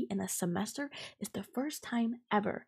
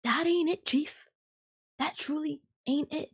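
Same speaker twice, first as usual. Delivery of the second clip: higher, louder exasperated call-out; indignant; 6 semitones higher, 5 dB louder than usual. Real, not acted. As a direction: throw it out loud, fed up.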